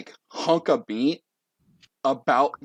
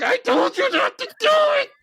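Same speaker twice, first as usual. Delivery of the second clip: shrieked, very high tantrum; total meltdown; 5 dB louder than usual. Acted. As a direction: scream it as you fall apart. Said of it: "a total meltdown".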